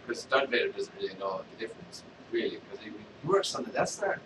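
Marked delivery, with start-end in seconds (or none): none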